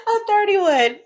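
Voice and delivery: laughing it out, high and loud. Real, not acted. Friend laughing at her age mix-up, high laughing voice. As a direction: blurt your own age through real laughter.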